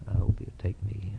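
hushed whisper to himself. Real, not acted. Preacher confiding his own hope to John, whispered. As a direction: breathe it out, almost unheard.

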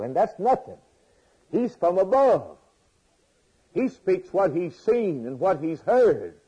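loud, high, fervent sermon; preaching at full force. Acted. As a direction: proclaim it to the whole church.

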